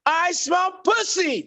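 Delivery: high, slow, drawn-out cry; catchphrase squeal. Retold or imitated. Blurting it right before guests open the door, high drawn-out squeal. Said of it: "right before they open the door".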